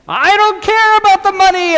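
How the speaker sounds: high and loud, a hammed-up frightened shriek; panicked cry. Shepherdess fleeing the big bad wolf, panicked high cry. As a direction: cry it out as she runs from the wolf.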